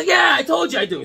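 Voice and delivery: high, loud exasperated shout; impatient insistence. Acted. Fisherman insisting to the stranger, impatient shout. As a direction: shout it like you're fed up being asked. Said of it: "He goes".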